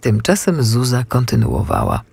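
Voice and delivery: usual speaking voice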